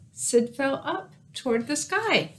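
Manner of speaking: plain reading voice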